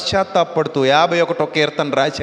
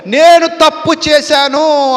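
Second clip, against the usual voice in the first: high, loud, slow anguished admission; tearful confession; 8 semitones higher, 7 dB louder, slower than usual. Acted. King admitting his wrong in tears, high and loud. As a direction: drag the words out in grief. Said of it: "కన్నీటితో ఏడుస్తూ".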